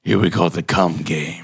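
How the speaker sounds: deep put-on voice. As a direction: drop the voice low and deadpan.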